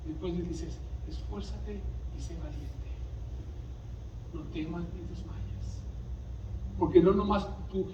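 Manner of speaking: quiet prayer. Retold or imitated. Preacher retelling his prayer to God, hushed. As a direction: murmur it as a private prayer. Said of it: "Yo le oraba y decía".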